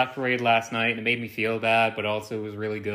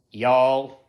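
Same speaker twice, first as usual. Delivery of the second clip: loud mock Southern "y'all"; Southern accent; 6 dB louder than usual. Shown as a demonstration. Podcaster attempting an accent on request, mock Southern. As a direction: try a too-Texan Southern drawl. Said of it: "Do a Southern accent".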